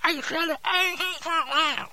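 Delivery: mouth full. Acted. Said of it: "and finish your chewing".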